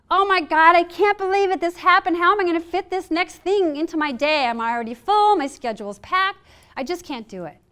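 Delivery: high, loud overwhelmed panic that sags at the end; panicked freak-out. Shown as a demonstration. Coach acts out a stressed freak-out for the audience, high and loud panic. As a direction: blurt it out like your day just collapsed. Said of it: "So you can hear this".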